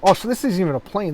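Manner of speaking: real surprised correction; surprised realization. Real, not acted. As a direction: catch your own mistake out loud, pitch jumping up. Realizes his mistake about the plane.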